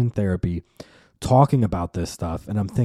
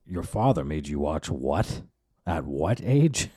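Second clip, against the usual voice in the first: imitating the therapist; 6 semitones lower, 6 dB quieter than usual. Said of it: "your therapist is like".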